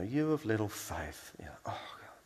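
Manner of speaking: resigned and exasperated, fading to a breath; long-suffering resignation. Shown as a demonstration. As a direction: sigh it out, slightly frustrated. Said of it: "resigned kind of tone".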